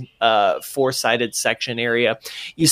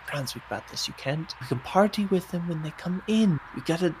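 much softer, as if heard from a distant room; distant voice upstairs; 3 semitones higher, 10 dB quieter than usual. Acted. Nico answering Franz from upstairs, distant and muffled. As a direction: sound like you're calling from far upstairs.

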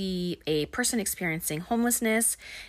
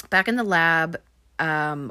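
ordinary delivery

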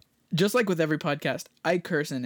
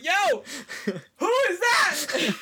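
high-pitched, drawn-out hype reaction; amazed crowd; 11 semitones higher, much slower than usual. Retold or imitated. Crowd reacting to a performer, high and amazed. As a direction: imitate a stunned onlooker calling out.

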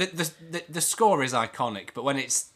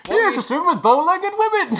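Goofy voice; 7 dB louder than usual. Retold or imitated. Imitating Quint's Goofy voice.

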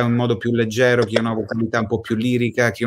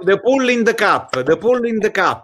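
put-on Sicilian accent; 10 semitones higher than usual. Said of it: "il siciliano che parla inglese".

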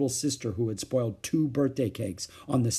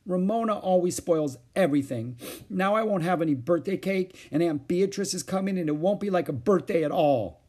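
higher-pitched, mildly tearful grumbling; upset complaint; 6 semitones higher than usual. A narrator's reading. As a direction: complain with a touch of distress. Beezus complains, close to tears.

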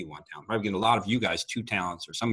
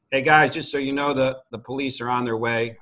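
loud, higher call to a group across the street; 5 semitones higher, 8 dB louder than usual. Retold or imitated. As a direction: project it to break up the fight. Calling out to a street fight.

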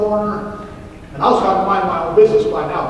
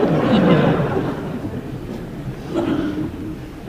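laughter. Real, not acted. Laughing at the cement mixer tears.

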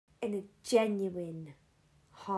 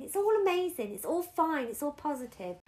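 high, louder mock cheerfulness, easing off by the end; overly positive people; 6 semitones higher, 5 dB louder than usual. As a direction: imitate relentlessly upbeat callers. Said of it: "with people saying".

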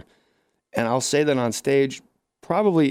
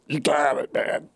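choked voice. Acted. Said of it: "freaked out 'cause the guy choked".

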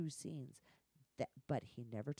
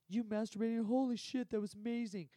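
loud, higher, thrilled praise; gushing fan; 7 semitones higher, 6 dB louder than usual. Retold or imitated. Fan praising the performer's bonus scene, excited. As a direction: imitate a fan gushing over a favourite clip. Acting out an admiring viewer's praise.

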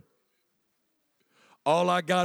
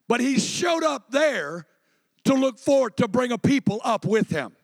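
impassioned preaching; 7 semitones higher than usual. Real, not acted. Preaching at full force.

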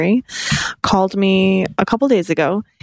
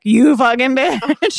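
mock outrage; 4 semitones higher, much slower than usual. Sister's future reaction acted out.

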